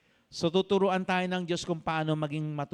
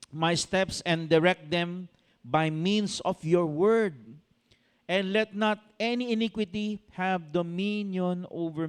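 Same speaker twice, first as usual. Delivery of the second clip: slow, solemn reading of the verse; scripture reading; 2 semitones higher than usual. Acted. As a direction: read the verse slowly. Reads scripture in a preaching voice. (mabagal na pagbasa ng talata)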